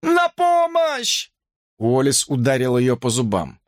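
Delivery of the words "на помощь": high, slow drawn-out scream; desperate scream; scream for help. A narrator's reading. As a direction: cry for help. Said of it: "закричала Брук".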